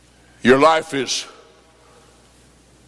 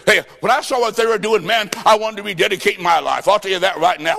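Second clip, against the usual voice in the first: usual speaking voice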